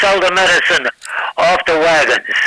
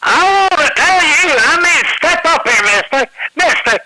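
put-on much higher, southern accent medicine-show hawker; southern accent carnival barker, pitched way up; Colonel Parker southern pitchman; 8 semitones higher than usual. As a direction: sell it like a Southern snake-oil man with a southern accent. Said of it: "in his dialect".

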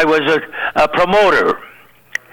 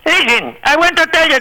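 high-pitched mock Hervé warning; imitating jealous Hervé; 11 semitones higher than usual. Hervé warns Shecky off his wife, high mock voice. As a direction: squeak it up like a jealous little man. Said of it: "And he says".

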